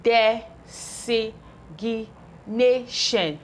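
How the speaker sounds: "designation" stretched out very slowly, syllable by syllable; very slow blending. Shown as a demonstration. Teacher to pupils, stretching the word out very slowly. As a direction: draw out every syllable of "designation". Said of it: "We have numeric".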